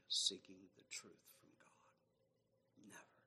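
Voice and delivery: breathy soft whisper. Acted. Preacher whispering his final point. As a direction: let it fade to a whisper. Hushed close of the sermon.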